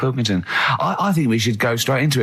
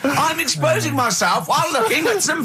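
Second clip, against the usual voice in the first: lightly voiced Carl, laughing at the end; hammed-up reply to the wife; mock Carl; 7 semitones higher than usual. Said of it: "acting out Carl and Suzanne".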